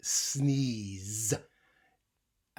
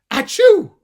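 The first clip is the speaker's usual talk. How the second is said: huge high loud sneeze; big sneeze; 23 dB louder than usual. Acted. The hog finally sneezing at the end, huge and loud. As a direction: fire off a giant achoo.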